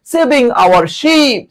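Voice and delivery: sing-song it slowly to drive it home. Shown as a demonstration. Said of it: "এসে হচ্ছে তোমার শিপও বলা যায়".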